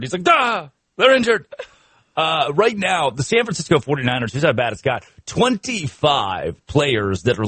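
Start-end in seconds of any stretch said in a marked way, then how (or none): 0.2-1.5 high, hammed-up blurt; mock startled outburst. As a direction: imitate a flustered reply, pitched right up. Imitating the startled coach's reply.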